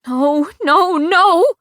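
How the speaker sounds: building to a very high, loud scream; escalating anguished cry; rising wail. A narrator's reading. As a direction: wail in disbelief. Kai dying.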